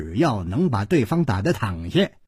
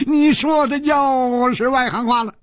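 gloating through chuckles; cackle, then talk with laughter bubbling up; tickled old-timer mocking his victim; cackling old man; 8 semitones higher than usual. A narrator's reading. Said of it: "土狗翁咯咯的笑".

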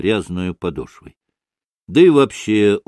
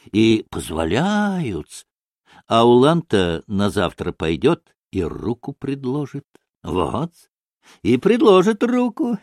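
higher, drawn-out, relishing teller of gossip; ingratiating storyteller figure; 5 semitones higher than usual. Acted. Maksimov tells of Polish girls and uhlans, relishing it. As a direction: savour each little detail, lingering and lilting.

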